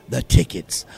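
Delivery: booming low announcer reveal; deep announcer voice. Acted. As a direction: drop into a big movie-trailer voice. Dramatic payoff of ticket promo.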